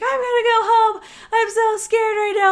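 put-on high, loud, panicked wail of a frightened girl; imitating a crying scared friend. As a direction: mimic a terrified kid begging to go home. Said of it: "bawling her eyes out, crying".

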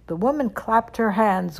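ordinary delivery, nothing marked

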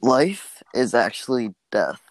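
mock-profound hippie voice. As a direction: deliver it as a spaced-out hippie revelation. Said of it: "the way you did that voice".

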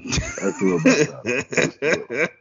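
words half lost in a laugh. Real, not acted. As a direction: laugh through the line, slowly. Laughing while answering about age.

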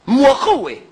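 Shaanxi dialect. Shown as a demonstration. Bao Gong tells the guards to step back, in Shaanxi dialect. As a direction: bark 莫后位 in Shaanxi dialect. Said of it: "拿陕西话".

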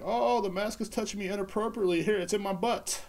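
high-pitched mock outrage voice; mock complainer. Host playing someone touched by a mask, high mock complaint. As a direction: pitch it way up as a silly victim.

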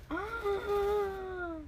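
excited shrieks. Real, not acted. Water splashing on clothes.

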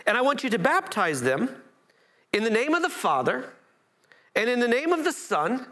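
preacher voicing Jesus sending out disciples; preaching at full force. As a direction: preach it with ringing authority. Reads Jesus' commission, preaching.

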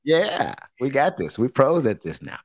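ordinary talk, nothing marked

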